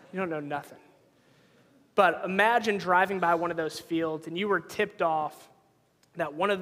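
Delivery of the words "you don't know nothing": quick soft line through a laugh; laughing tease. Retold or imitated. Dad laughing at his kids' idea of country, quick and amused. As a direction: laugh it off at the kids. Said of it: "And I'm like".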